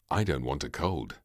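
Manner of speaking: usual speaking voice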